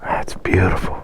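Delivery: awed whisper. Real, not acted. Awed at old family house.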